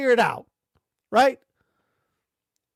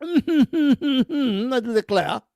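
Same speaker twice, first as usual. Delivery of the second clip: laughing mock line; much slower than usual.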